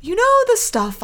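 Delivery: high-pitched imitation of a batty verger; Alice Tinker. Batty vicar's assistant explaining her thing, imitated high-pitched. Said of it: "Alice just has this whole thing".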